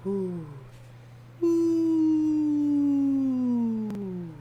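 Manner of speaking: high mock owl hoots; owl hooting. Caller hooting down the phone at him, high owl hoots. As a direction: hoot it like a drunk owl. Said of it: "Got a fucking owl on the phone?".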